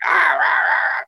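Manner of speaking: mock a puppet flapping its mouth. Shown as a demonstration. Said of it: "nobody talks like".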